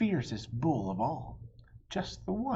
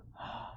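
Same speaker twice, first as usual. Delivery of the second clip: gasp; 12 dB quieter than usual. Acted. Dramatic pause in story.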